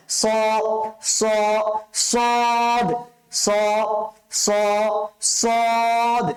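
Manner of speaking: স, স, then long heavy সদ; thick sad drill. Shown as a demonstration. Teacher drills students in rhythm, thick held সদ. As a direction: build from স to a stretched, rounded সদ. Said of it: "এভাবে উচ্চারণ করবেন".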